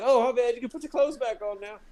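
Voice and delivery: high mock clerk voice with a laugh; clerk's apology. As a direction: play the embarrassed clerk apologizing. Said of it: "He's like".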